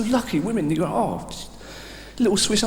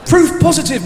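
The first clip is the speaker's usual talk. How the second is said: emphatic rant; 9 semitones higher, 6 dB louder than usual. Acted. Comedian opens rant with emphasis.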